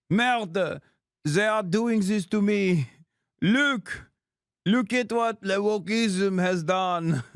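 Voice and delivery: over-the-top French whine, pleading; hammed-up French accent. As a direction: wail like a French activist begging Macron for rescue. Mocking a French protester.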